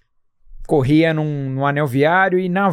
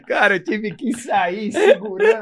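laughing; 5 semitones higher than usual. Real, not acted. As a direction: talk through laughter, a bit higher. Laughing while telling punchline. (falando entre risos)

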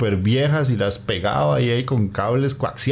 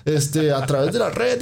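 amazed; 9 semitones higher than usual. Retold or imitated. Mimicking amazement at file sharing.

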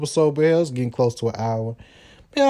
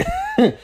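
quick high-pitched laugh; a short, amused laugh up high. Real, not acted. Laughs about getting paid.

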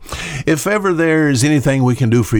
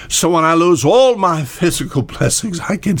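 laughing; 6 semitones higher than usual. Real, not acted. Laughs mid-sentence while preaching.

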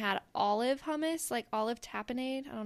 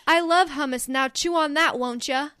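loud, punchy read of an enthusiastic text; excited texted quote; 10 dB louder than usual. Retold or imitated. Friend reading out Curtis's hummus text, loud mock enthusiasm. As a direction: announce it loudly with playful gusto. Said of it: "and he said".

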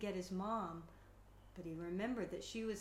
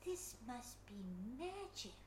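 soft awe; 5 dB quieter than usual. Acted. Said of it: "he said softly".

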